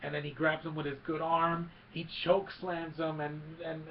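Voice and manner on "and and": ordinary talk, nothing marked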